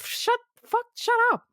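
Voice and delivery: high mock yell at the screen; exasperated shout. Film fan yelling at the French guy, exasperated mock shout. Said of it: "And I'm like".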